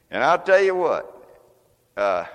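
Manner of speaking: ordinary talk, nothing marked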